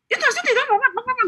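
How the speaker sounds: high, quick mock Makassar chatter; fast Makassar talker. Imitating a fast-talking lady from Makassar, high and quick. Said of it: "cepet kalau ngomong".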